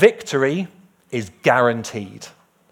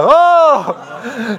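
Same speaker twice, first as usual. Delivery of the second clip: loud, high, held "oh"; big theatrical oooh over a name; exaggerated drawn-out "oh"; 11 semitones higher, 10 dB louder than usual. Reacting to Diane Taylor's name, exaggerated high oh.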